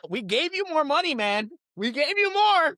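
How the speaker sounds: high-pitched, incredulous protest; exasperated rant. Pretend. Fan confronting the player who turned the team down, exasperated.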